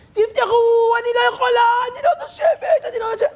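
very high mock-panicked pleading; panicked trapped woman. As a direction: play a stressed neighbor begging to get out. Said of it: "היא לחוצה".

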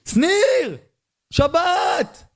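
high, far-off calling voice; mock heavenly shout; God calling out. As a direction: call down from the heavens. God calls again.

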